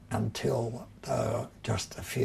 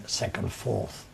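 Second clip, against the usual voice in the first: ordinary talk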